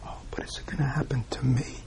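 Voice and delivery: breathy, voiceless "is it gonna happen to me?"; whispering the personal dread; whispered question. Retold or imitated. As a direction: let the fear slip out in a whisper. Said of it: "We, we, we know that".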